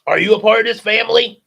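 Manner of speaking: loud, fast, demanding; movie man confronting the girl loudly; shouted question. Acted. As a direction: bark the question at her.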